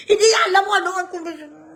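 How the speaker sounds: exasperated shout. Real, not acted. Vlogger yelling at the senator in disbelief. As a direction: yell it at him in disbelief.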